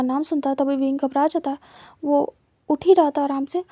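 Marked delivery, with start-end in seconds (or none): none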